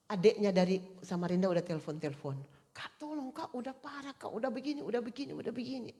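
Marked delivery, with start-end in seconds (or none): 2.8-5.9 higher, hushed, urgent begging; pleading. Retold or imitated. Younger sister phoning in panic, hushed high pleading. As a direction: beg for help, higher and softer.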